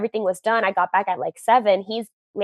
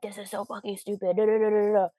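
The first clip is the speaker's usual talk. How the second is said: imitating a grumbler, suddenly much softer; mumbling under breath; 6 dB quieter than usual. Retelling boyfriend's grumbling while moping around, mumbled under breath. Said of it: "mumbling under his breath".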